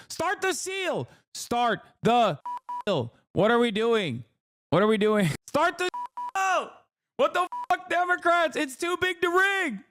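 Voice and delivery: very high strained scream; mock meltdown. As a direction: scream like you've completely lost it, in jest. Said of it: "I'm losing my fucking mind a little bit".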